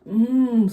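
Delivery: loud delighted mmm; yummy mmm. Acted. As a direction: savor it like a treat. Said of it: "said Walter".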